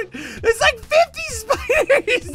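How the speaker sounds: very high, slow squeal; stretched high-pitched shriek; laughing panic. Pretend. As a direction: squeal it in giggly mock horror. Swarmed by spiders in game.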